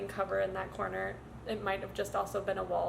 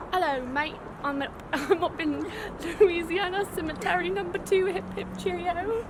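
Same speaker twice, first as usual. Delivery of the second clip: deliberately bad British accent, pitched up; 7 semitones higher than usual. Shown as a demonstration. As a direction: put on a hammy British accent, drawing out the end. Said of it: "I'll do a bad accent".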